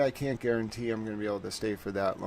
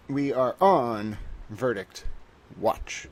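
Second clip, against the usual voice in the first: catchphrase announcement; 5 dB louder, slower than usual. Acted. Announcing the verdict watch.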